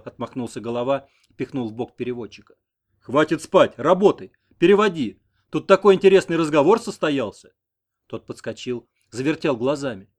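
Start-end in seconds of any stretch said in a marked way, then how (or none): 3.0-7.5 louder commanding prod; bossy order. A narrator's reading. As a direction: wake him up and set him to work. Headman orders sleepy interpreter. (громкий начальственный приказ)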